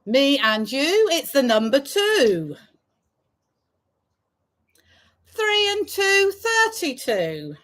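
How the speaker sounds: sing-song bingo number calls; drawn-out call then quick repeat; bingo caller. Acted.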